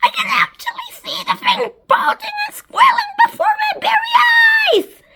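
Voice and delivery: very high, loud character shriek; high-pitched astonished yell. A narrator's reading. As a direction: shriek it in amazement, stretching the last words. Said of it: "And Spiker yelled".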